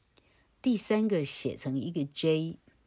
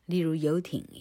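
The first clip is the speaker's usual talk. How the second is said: ordinary delivery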